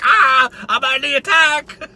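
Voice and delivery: slow, dim-witted mock growl; lightly hammed-up dopey attacker; dopey shark voice. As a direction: play a dumb shark on the attack. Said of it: "you're just like".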